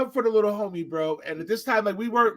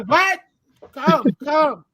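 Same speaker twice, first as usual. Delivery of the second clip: loud, raised calling out; feigning deafness; 5 semitones higher, 4 dB louder, much slower than usual. Retold or imitated. Bouncer beckons the drunk man outside, feigning deafness loudly. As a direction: call him over as if you can't hear. Pretends not to hear, beckons him out.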